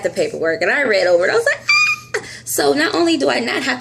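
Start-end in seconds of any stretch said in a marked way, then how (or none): 1.6-2.3 high, delighted shriek; joyful scream. Retold or imitated. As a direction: squeal with joy at the news. Reading lab paperwork.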